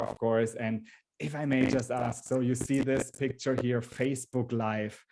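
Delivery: ordinary talk, nothing marked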